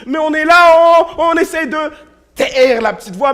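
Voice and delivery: loud, driving sermon voice, pushing hard; fervent preaching. Acted. Preacher rouses the conference about the inner voice, loud and fervent. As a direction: hammer the point home to the congregation. Preacher builds to full force.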